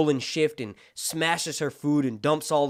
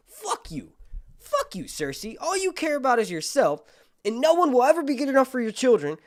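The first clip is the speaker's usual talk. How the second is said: rising into a high, loud mock tirade; angry telling-off; 10 semitones higher, 5 dB louder than usual. Marjorie rejecting Cersei's bullshit, angry tirade. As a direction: build from a curt insult to high outrage.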